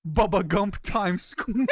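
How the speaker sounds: high, slow line breaking into a laugh; imitating a comic cracking up mid-joke; laughing through it. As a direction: fight the giggle as you deliver the punchline. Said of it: "talking to Colbert".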